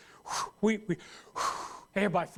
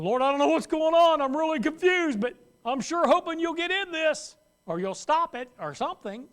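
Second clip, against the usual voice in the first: mock confused prayer; 5 semitones higher, 5 dB louder than usual.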